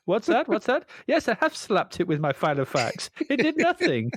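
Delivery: high panicked caller voice; frantic, stuck up a tree; being chased. Pretend. Acted caller voice.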